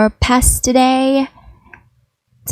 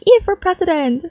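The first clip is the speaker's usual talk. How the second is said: excited cheer; 8 semitones higher than usual. Retold or imitated. Chat comment voiced.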